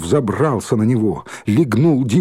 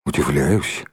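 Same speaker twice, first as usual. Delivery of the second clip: low, brief grandfatherly answer; quick low-pitched reply; 9 semitones lower, slower than usual. A narrator's reading. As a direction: answer promptly in a much lower voice. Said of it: "быстро ответил Николай Николаевич".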